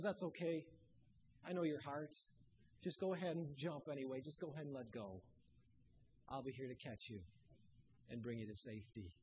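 low, soft, soothing voice of God; tender comfort. Acted. God comforting a believer whose faith is drained, soft and low. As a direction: ease it down gently, like calming a child.

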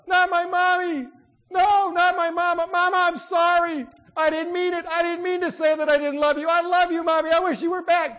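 high, very loud grief-stricken cry; anguished wail. Acted. Girl learning her mother died, anguished wailing. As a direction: wail it out, begging her back.

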